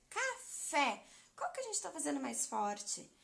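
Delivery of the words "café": café with fé clearly marked; stress on FÉ. Shown as a demonstration. Teacher answering her own question, fé stressed. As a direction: lean on fé in café.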